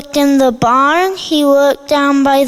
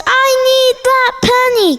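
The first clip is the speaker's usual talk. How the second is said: eager dog; 7 semitones higher, faster than usual. A narrator's reading. Dog says.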